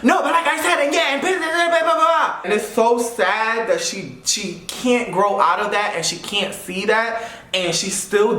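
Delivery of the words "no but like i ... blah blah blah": much higher, dismissive gibberish rant; mock friend's ranting. Mocking the friend who wouldn't let him finish. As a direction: parody her talking over me. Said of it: "Like, everything was like".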